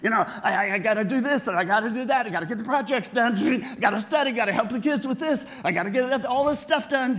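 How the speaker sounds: rapid, rising, rushed listing of chores; frantic busy person. Pretend. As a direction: rattle it off breathlessly like you're drowning in tasks.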